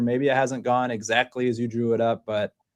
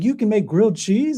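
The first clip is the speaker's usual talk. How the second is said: put-on amazed kid; 11 semitones higher than usual. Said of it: "He's like".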